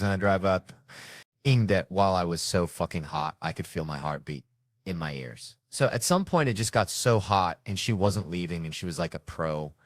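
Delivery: ordinary talk, nothing marked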